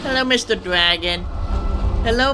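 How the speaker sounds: usual speaking voice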